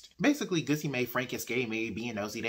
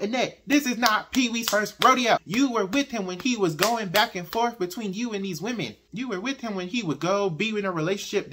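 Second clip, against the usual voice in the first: exasperated rant; 8 semitones higher, 7 dB louder than usual. Retold or imitated. Ranting at the character about Pee-wee.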